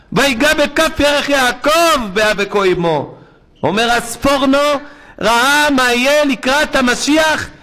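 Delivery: high, loud, slow fervent preaching; preaching at full force. Acted. Rabbi thunders the verse to his congregation, fervent preaching. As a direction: drive the verse home to the crowd.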